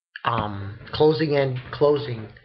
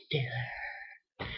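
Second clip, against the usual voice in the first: whispered, much softer echo of filler; dramatic whisper; 2 semitones lower, 15 dB quieter than usual. Real, not acted. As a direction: breathe the word filler out like a spooky secret.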